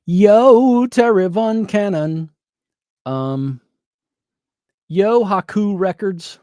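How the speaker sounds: high, loud, slow greetings; excited shout-out. Real, not acted. Greeting viewers in the chat, excited shout-outs. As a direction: call out viewers' names.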